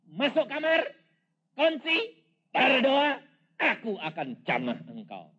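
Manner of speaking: booming high voice of God commanding; loud voice of the Lord; loud divine command. Retold or imitated. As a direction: proclaim it as God's order. God's voice speaking in testimony.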